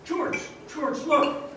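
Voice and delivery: high, sharp urgent call to George; urgent alarm. Acted. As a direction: grab his attention fast, alarmed. Clarence spots Mr. Gower, urgent.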